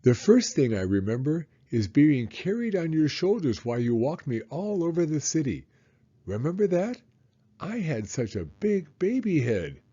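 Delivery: a hint of a small boy talking to his dad; lightly voiced little boy. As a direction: read it as the excited little boy. Child voice reading son's lines.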